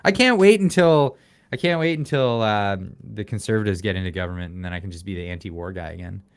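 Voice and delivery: normal talking voice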